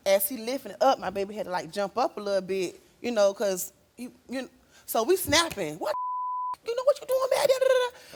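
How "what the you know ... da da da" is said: retold yell at the abuser; angry yell. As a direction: yell at him, catching him. Retelling confronting Robert.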